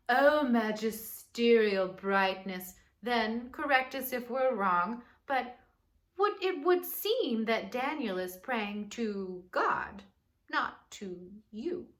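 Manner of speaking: butter up the king with a sly grovel; fawning courtiers. Acted. Sneaky helpers flattering the king to trap Daniel.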